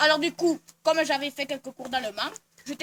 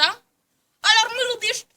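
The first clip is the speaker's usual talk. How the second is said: much higher, hammed-up Brazilian-Portuguese accent; sing-song put-on Brazilian accent, held throughout; mock Brazilian accent; 10 semitones higher, 7 dB louder than usual. Woman retelling her Brazil trip in a mock Brazilian accent. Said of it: "je t'aurais pris leur accent".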